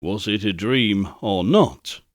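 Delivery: usual speaking voice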